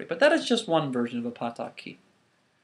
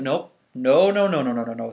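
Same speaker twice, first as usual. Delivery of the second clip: firm refusal; 8 dB louder than usual. Acted. Obatala refuses a third time.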